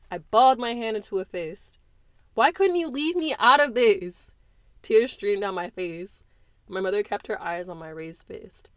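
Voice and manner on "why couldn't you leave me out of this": loud, high, pleading accusation; tearful anger. Acted. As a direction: demand it through tears. Crying daughter confronts mother.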